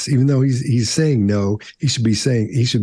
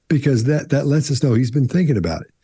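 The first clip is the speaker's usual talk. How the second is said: ordinary talk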